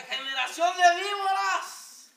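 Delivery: a prophet roaring at the crowd, drawn out; Juan el Bautista thundering. Retold or imitated. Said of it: "si estuviera Juan el Bautista aquí predicándonos, hermanos, diría".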